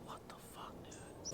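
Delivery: hushed, baffled aside; barely voiced exasperation; bewildered whisper. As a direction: whisper it in mock disbelief. Retells his bewildered reaction.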